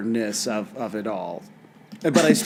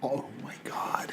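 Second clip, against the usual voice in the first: breathless shocked whisper; 13 dB quieter than usual. Real, not acted. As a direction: whisper it under your breath. Shocked aside while email is read.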